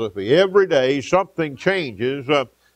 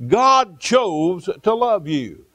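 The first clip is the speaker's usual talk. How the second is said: slow emphasis; 4 semitones higher, much slower than usual. Real, not acted. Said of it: "slow emphatic preaching".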